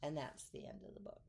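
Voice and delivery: quick soft whisper; whispered ending. Real, not acted. Closing the book.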